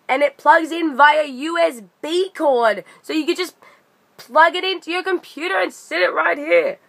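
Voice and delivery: proud, delighted gadget show-and-tell; excited. Real, not acted. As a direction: gush about the little fan. Showing off a new gadget.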